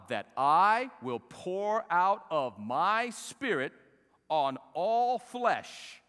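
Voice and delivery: slow, loud, weighty proclamation; high, emphatic scripture declamation; preach. Acted. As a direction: draw out each word of the prophecy.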